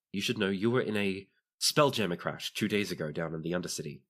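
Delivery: ordinary delivery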